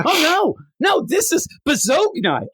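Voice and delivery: high, hammed-up insistence; mock correcting mother. Mother renaming the same dinner, high mock insistence.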